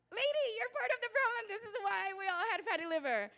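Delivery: high, loud exasperated yell; mock outraged scolding. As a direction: playfully holler the blame at mom. Mock scolding of her mother.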